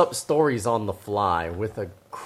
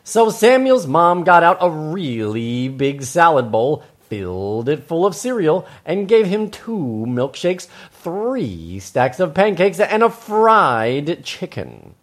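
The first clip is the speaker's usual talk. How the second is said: slow, higher, swooping narration; animated children's storytelling; 6 semitones higher, 5 dB louder, slower than usual. Acted. Host piles up the food for children, exaggerated storytelling. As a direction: relish each item on the list.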